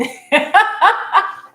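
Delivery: high, loud burst of giggles; real cackle in a string of bursts; laughing. Real, not acted. Cracking up at her own silly phrase, loud high laughter.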